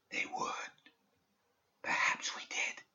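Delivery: slow, breathless whisper from far off; ghostly whisper. A narrator's reading. Dead student's voice reaching Perdita's mind, ghostly whisper. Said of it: "Its whispering grew faint".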